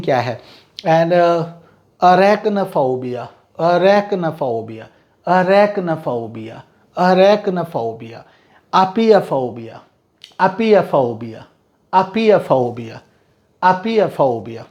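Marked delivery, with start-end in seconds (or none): 2.0-8.2 arachnophobia said very slowly, a-rek-na-pho-bia; model pronunciation. Shown as a demonstration. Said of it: "vocabulary drill, repeated slowly". 8.7-14.6 apiaphobia drawn out slowly, a-pia-pho-bia; teacher repeating apiaphobia slowly; model pronunciation. Shown as a demonstration. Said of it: "vocabulary drill, repeated slowly".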